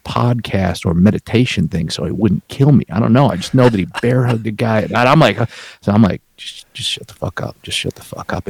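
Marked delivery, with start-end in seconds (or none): 6.3-8.5 hushed, nearly whispered warning to himself; inner self-talk. Retold or imitated. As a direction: whisper it under your breath, like a private thought. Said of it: "In my head, I'm like".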